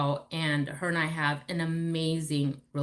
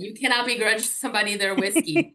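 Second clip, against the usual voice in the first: higher and louder, laughing through the words; 6 semitones higher, 6 dB louder than usual. Real, not acted. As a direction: laugh it out, teasing about the whiskey. Joking about whiskey.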